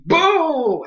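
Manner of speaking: high, loud triumphant boom; hyped boom. Retold or imitated. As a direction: call the hit like a fan. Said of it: "And I was like".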